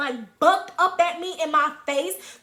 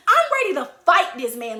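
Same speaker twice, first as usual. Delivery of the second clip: ordinary delivery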